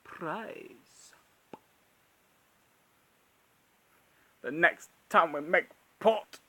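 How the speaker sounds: slow, hushed pirate menace; lightly voiced Davy Jones; Davy Jones impression. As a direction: rasp it like a sea captain. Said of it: "Davy Jones, where he goes".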